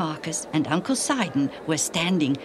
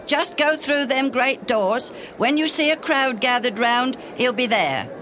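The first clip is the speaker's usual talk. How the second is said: gruff stranger; 8 semitones higher, 4 dB louder than usual. A narrator's reading. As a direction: point the way, bluff and loud. Stranger giving directions.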